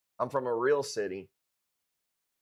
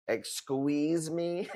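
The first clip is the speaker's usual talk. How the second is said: drawn-out, higher mock indignation; 4 semitones higher, much slower than usual.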